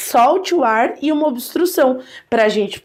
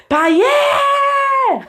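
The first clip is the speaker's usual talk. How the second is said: long, much higher "pai é!" shouted across a distance; calling from afar; 14 semitones higher than usual. Shown as a demonstration. Teacher acting out calling dad from a distance. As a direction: call out "pai é!" to someone far away. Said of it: "quando chama a distância".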